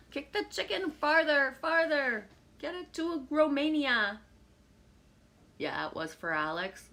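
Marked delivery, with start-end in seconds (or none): 0.1-4.2 higher, louder cheering shout; playful calling out. Pretend. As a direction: cheer the chicken on like a fan at a match. Playfully calling out about chicken.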